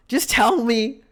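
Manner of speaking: punched-out frustrated appeal; insistent demand. Retold or imitated. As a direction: press the point, exasperated. Same plea repeated, more forcefully.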